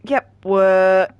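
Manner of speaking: hiccuping mid-sentence; speaking through hiccups. Real, not acted. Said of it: "got the hiccups now".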